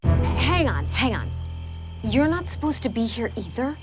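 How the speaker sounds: ordinary talk, nothing marked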